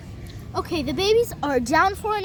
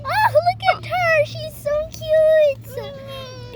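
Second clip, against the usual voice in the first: cooing; 9 semitones higher, much slower than usual. Pretend. Cooing over the baby dolls.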